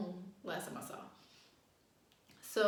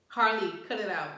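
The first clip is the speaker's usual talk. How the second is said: loud, firm command to a dog; real scolding; 11 dB louder than usual. Real, not acted. Owner telling off the puppy Harley, real loud scolding. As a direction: raise the voice hard to stop the puppy.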